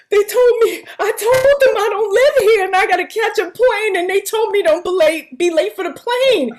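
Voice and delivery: blurting it out near tears, pitched high; tearful retelling. Retold or imitated. Retelling tearful call to manager.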